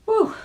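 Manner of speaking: very high whew; a big relieved whistle-breath; whew of relief. A narrator's reading. Narrator relief after gunshot.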